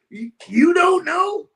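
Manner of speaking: high, loud, drawn-out retold disbelief; incredulous. Renter confronts the stranger in the room, loudly incredulous. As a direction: echo him slowly, voice shooting up. Incredulous reply to startled tenant.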